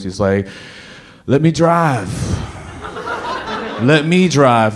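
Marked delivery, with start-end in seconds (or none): 1.3-4.8 lightly mimicked groggy, confused mumble, higher; a mild imitation of a dazed friend. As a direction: play half-awake and muddled, lightly. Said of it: "in this very confused, groggy voice".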